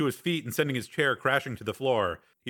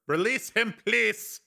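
put-on higher, firmer accented demand; Breck, insistent; 10 semitones higher, slower than usual. A narrator's reading. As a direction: insist on release in a foreign accent. Breck repeats request, more insistent.

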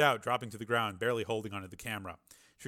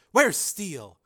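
high, loud demand; urgent shout; 7 semitones higher, 10 dB louder, slower than usual. A narrator's reading. Reporter demanding news from her rival, urgent shout. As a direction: call out over the battle.

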